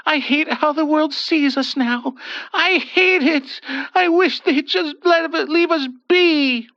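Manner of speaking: mild tearful frustration rising; frustrated crying. A narrator's reading. Ethel venting about the world, tearful frustration. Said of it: "cried in gushing frustration".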